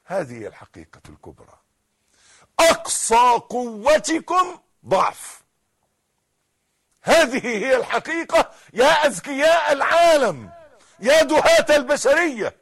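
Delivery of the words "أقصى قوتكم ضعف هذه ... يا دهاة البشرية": loud, high challenge to the world; full-force preaching. Real, not acted.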